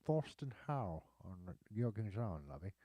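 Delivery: drawn-out mock upper-crust accent; slow upper-crust accent with affected vowels; Thurston Howell. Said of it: "here we talk like Thorsten Howe".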